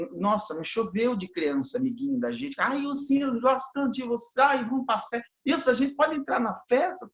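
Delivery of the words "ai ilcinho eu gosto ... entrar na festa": put-on high, sweet pleading kid voice; begging child voice. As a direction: coax like an eager little kid. Kids flattering to get into party.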